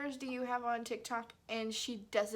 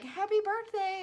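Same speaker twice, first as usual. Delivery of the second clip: high, lifted birthday cheer; imitated cheerful greeting; 8 semitones higher, 4 dB louder than usual. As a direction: imitate excited well-wishers. Voices friends' birthday greeting.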